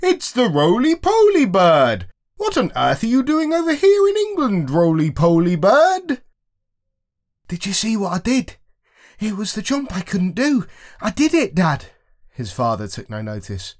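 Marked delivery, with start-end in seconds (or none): 0.0-4.7 much higher, louder monkey chatter; monkey voices. Acted. Monkeys greet the roly-poly bird, high squealing monkey voice. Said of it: "cried all the monkeys". 4.7-6.2 higher, slow drawn-out monkey call. Acted. Monkeys question the roly-poly bird, high slow monkey voice. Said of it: "cried all the monkeys". 7.5-11.9 put-on much higher, eager child voice; a boy bursting with pride; excited boy. Son tells his dad about the jump, high and excited.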